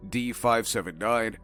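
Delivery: ordinary delivery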